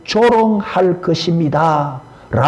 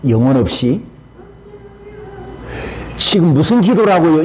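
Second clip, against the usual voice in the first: ordinary talk, nothing marked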